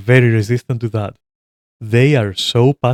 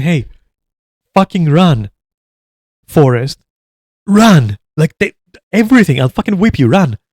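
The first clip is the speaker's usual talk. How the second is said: pushy shout; 6 semitones higher, 5 dB louder than usual. Retold or imitated. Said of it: "I tell them".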